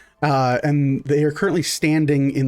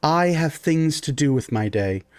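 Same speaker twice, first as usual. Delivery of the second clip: pompous priestess. Acted. Priestess Jasana greets party.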